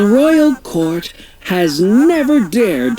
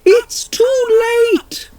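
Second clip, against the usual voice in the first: high, crying shout of panic; shrill panicked royal shout; yelled. Acted. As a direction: scream it as they fall. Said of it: "yelled the Queen".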